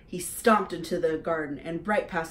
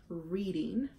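ordinary delivery

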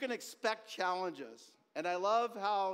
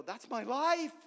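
acted astonishment; 6 semitones higher, 5 dB louder, slower than usual. Voices listener's astonished reaction.

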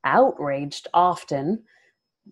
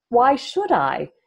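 slow, louder, higher defiant demand; 7 semitones higher, 7 dB louder, slower than usual. A narrator's reading. Nynaeve demanding answers from the warder. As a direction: challenge him, leaning on each word. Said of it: "Nynaeve demanded".

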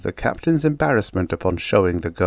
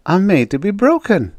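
high-pitched carefree comeback; acted breezy confidence; light, breezy; 11 semitones higher, 5 dB louder than usual. A narrator's reading. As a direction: brush the objection off lightly. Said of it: "Janie answered lightly".

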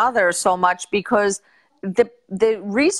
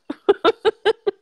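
high laugh; 11 semitones higher than usual. Real, not acted. Teasing laugh.

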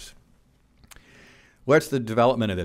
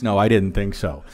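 normal talking voice

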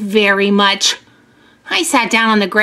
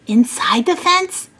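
usual speaking voice

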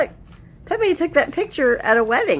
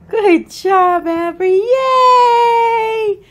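excited cheering; 12 semitones higher, 8 dB louder, slower than usual. Real, not acted. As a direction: cheer her on like she just won. Cheering toddler on.

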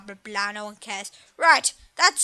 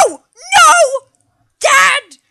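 put-on much higher, loud, crying-style kid scream; a boy shrieking in hammed-up despair; mock child scream; 10 semitones higher, 13 dB louder, much slower than usual. Son screaming at his dad, a high, hammed-up crying scream.

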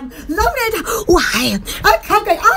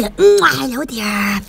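sultry greeting; 9 semitones lower, slower than usual. Shown as a demonstration.

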